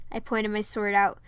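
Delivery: ordinary delivery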